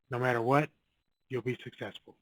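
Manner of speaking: ordinary delivery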